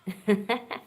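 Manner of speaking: sudden loud burst of laughter. Real, not acted. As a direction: crack up at your own joke. Laughs at own teasing.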